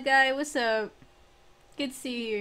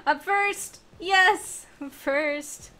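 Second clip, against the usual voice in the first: excited; 4 semitones higher, 5 dB louder, much slower than usual. Real, not acted. Winning first place in race.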